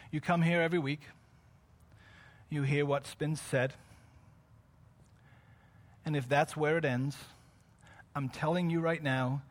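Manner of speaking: low, soft, measured preaching; solemn preaching appeal. Real, not acted. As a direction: press it home quietly, with weighty pauses. Grave, hushed appeal in sermon.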